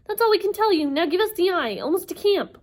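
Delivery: high, loud pleading; wailing. Acted. Inger begging Percy for the eye, wailing. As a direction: plead desperately at high pitch.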